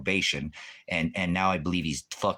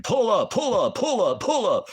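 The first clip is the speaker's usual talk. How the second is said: much higher, louder, squeaky taunting voice; mocking Brandon; 6 dB louder than usual. Mocking a viewer who wanted him to fight, high taunting yell. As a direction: mimic a guy egging on a fight. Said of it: "Brandon was in here thinking I was picking a fight".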